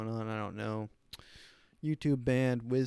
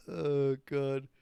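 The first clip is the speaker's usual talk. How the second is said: ordinary talk, nothing marked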